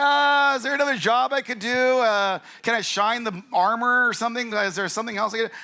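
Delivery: high-pitched, hesitant pleading; mock-squeamish haggling for another task; reluctant recruit. Acting a recruit dodging the job.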